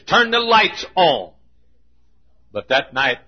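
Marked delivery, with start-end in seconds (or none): 0.0-1.3 slow down and lean on each word; slow insistent demand. Shown as a demonstration. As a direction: turn the lights on, said slowly and firmly. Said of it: "I don't like dark churches".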